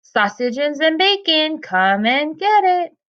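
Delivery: slow, high sing-song mom call. A narrator's reading. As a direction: sing it out to the children. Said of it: "called, uh, Adiola".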